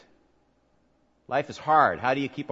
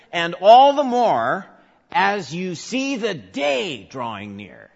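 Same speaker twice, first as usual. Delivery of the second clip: "and all the more" lifted high and loud, slowed; emphasized phrase; 8 semitones higher, 11 dB louder, slower than usual. Shown as a demonstration. Preacher showing how to emphasize Hebrews ten, slow and loud. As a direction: lean hard on "and all the more", then slow down. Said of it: "you'll see it when you emphasize different words".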